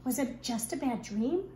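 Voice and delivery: plain reading voice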